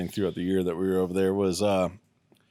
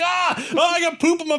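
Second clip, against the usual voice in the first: much higher, whiny mock squeal. Mocking the sergeant splattered with burning waste, high squeal.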